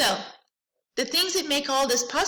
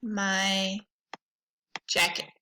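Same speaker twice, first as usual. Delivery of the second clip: slow dictation; 3 semitones lower, much slower than usual. Shown as a demonstration. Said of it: "And I would say".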